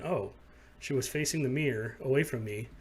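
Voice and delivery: normal talking voice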